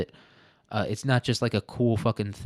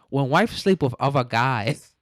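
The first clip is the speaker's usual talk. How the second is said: put-on higher, louder caricatured Chinese accent; mock Chinese accent; 3 semitones higher, 5 dB louder than usual. Reading out a fortune cookie line, mock Chinese accent. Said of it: "funny to do a Chinese accent".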